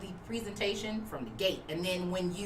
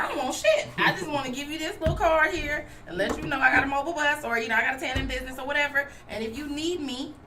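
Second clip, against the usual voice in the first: sales pitch voice; 6 semitones higher than usual. Retold or imitated. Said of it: "And you like".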